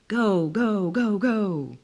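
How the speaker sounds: loud, high cheering from the bleachers; cheering scream. A narrator's reading. Pregnant wife screaming at the baseball game, loud cheering. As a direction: yell it out to the players on the field. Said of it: "you'd scream".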